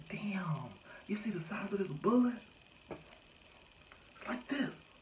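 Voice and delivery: mock-impressed cop marvelling at the bullet; amazed officer.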